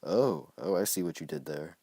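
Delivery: usual speaking voice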